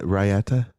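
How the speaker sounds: "Raita" as rye-AT-uh, three syllables, rising; questioning pronunciation. Shown as a demonstration. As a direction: try out "Raita" with an added middle syllable, rye-AT-uh. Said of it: "Is that how you say it".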